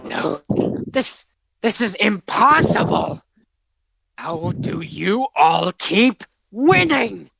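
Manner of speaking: high, slow, shocked villain cry; disbelieving villain. Acted. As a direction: rage that the heroes keep winning. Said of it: "Meccano's in disbelief".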